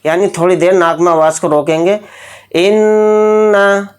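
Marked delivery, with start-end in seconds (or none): 2.6-3.9 इन्ना with the नून held in the nose a moment; nasal ghunna. Shown as a demonstration. Teacher showing how ghunna is done, nasal hold. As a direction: hold the sound of इन्ना in the nose before releasing. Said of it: "इस तरह से।".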